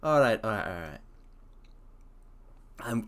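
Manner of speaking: ordinary delivery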